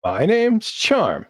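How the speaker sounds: high, slow, loud little creature; Charm. Acted. Small shopkeeper giving its name, high and drawn out. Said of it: "Charm says".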